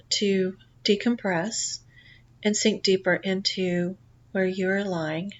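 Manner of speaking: calm guided relaxation read; guided meditation. Acted. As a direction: lull the listener gently into the journey. Leads a guided past life meditation.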